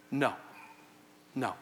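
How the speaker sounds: normal talking voice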